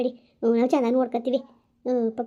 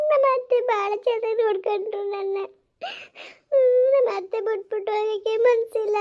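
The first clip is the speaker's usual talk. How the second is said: high tearful sobbing voice; crying; 7 semitones higher than usual. Acted. Daughter-in-law leaving her mother-in-law, crying high. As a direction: weep through the words, pitched up.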